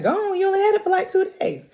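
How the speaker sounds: high-pitched disbelief, retold; mock-astonished protest; incredulous. Acted incredulous reply.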